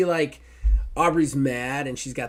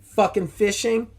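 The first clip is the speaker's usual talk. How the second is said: mock promo; 5 semitones higher, 4 dB louder than usual. GM hamming up promo.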